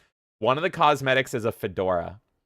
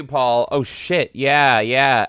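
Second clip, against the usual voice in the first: ordinary talk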